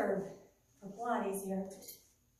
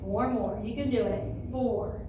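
encouraging coach; slower than usual. Real, not acted. Pushing class through last reps.

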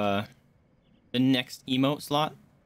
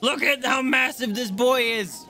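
high, drawn-out excited shout; 11 semitones higher, 4 dB louder, slower than usual. Real, not acted. Player showing off his giant horse, gleeful shout. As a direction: crow about it, slow and high.

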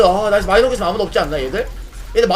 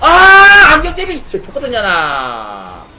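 angry shout; 6 semitones higher, 8 dB louder than usual. Real, not acted. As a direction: blow up at the enemy. Cursing enemy in game.

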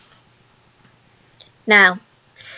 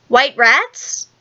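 lightly voiced eager guess; inspired cry; 3 semitones higher than usual. A narrator's reading. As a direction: pipe up with a sudden idea, questioning. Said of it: "cried Nims, inspired".

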